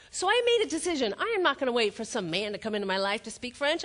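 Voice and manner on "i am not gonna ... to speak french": ordinary delivery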